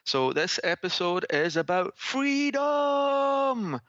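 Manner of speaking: put-on Scottish accent, drawn out and shouted. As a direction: William Wallace Scottish shout. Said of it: "I'm doing this in a Scottish accent on purpose".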